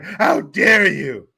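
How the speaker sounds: loud, very slow mock shout; mock outrage. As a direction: ham up the famous outburst. Quoting Greta Thunberg.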